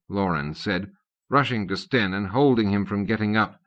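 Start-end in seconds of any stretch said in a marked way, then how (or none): none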